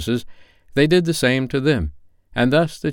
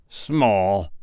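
echoing Scrooge. A narrator's reading. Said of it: "echoed Scrooge".

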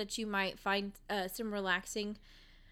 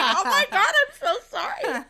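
high, loud, laughing through it; giggling apology, pitched way up; 10 semitones higher, 9 dB louder than usual. Retold or imitated. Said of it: "And I was like".

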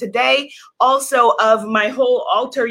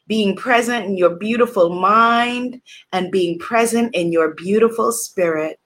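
guided meditation; 4 semitones lower than usual. Acted. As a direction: guide them into calm breathing. Leads a guided breathing meditation.

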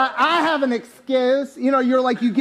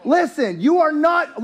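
ordinary delivery

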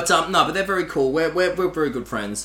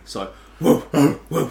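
short barked woofs; dog bark imitation; 2 semitones lower than usual. Shown as a demonstration. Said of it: "imitate the sound of a dog barking".

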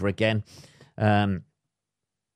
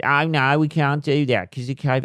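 mocking delivery men; 5 semitones higher, 3 dB louder than usual. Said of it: "the people turn up".

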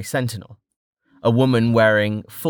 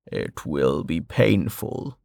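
low and very slow; solemn Westbridge; 5 semitones lower, 4 dB quieter, slower than usual. A narrator's reading. Westbridge announcing the moment, low and slow. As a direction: weigh every word.

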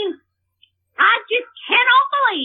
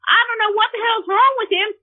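normal talking voice

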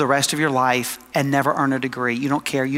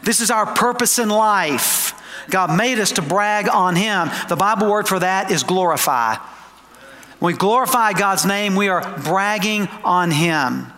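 emphatic preaching; 5 semitones higher than usual. Real, not acted. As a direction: preach it with conviction, stretching the big words. Preaches with rising force.

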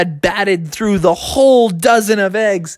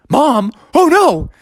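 higher, alarmed captain; Captain Crunch; 9 semitones higher, 3 dB louder, slower than usual. Acted. Captain learning Mom is back, alarmed. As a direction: rise into dismay on the second phrase. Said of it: "And Captain's like".

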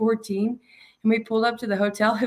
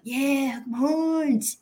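high, drawn-out cheer at normal volume; retold fan cheer; 6 semitones higher, much slower than usual. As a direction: re-enact hailing a fellow fan, pitch swooping up. Acted cheer at a fellow fan.

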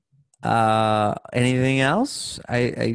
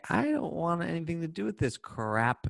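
dismissive; 7 semitones higher, 5 dB quieter than usual. Retold or imitated. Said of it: "you could say".

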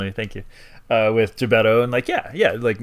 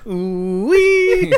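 ghostly wail; 12 semitones higher, 3 dB louder than usual. Retold or imitated. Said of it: "he goes".

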